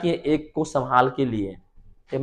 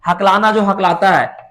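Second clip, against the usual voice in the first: ordinary talk, nothing marked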